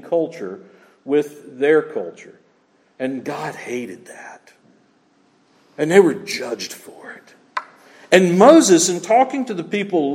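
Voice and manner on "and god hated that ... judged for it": slow dramatic whisper; whispered weight of judgment; hushed solemn. Real, not acted. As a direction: drop to a grave whisper. Solemn preaching point.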